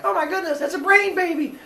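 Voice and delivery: high, shocked, laughing; freaked out. Acted. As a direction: gasp it out in alarm. Both gods freak out.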